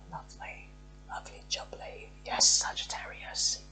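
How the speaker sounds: delighted whisper; pleased hushed whisper. Real, not acted. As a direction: breathe it out like a happy secret. Delighted aside over good card.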